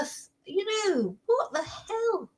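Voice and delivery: high, soft, slow disbelief; high, quiet, stunned; incredulous. Real, not acted. Viewer reacting to the news, high incredulous.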